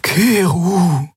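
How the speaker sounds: high, urgent plea of a name; insistent. A narrator's reading. Xea urging Keru, insistent and high. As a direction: press his name on him, earnestly. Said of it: "sagte Xea eindringlich".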